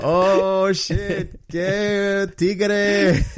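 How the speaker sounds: imitating Dominican slang with flair; Dominican accent. Showing how Dominicans talk, Dominican accent. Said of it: "the way Dominican talk".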